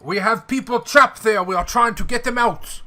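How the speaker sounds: French officer voice. Acted. NPC Louis pleads urgently.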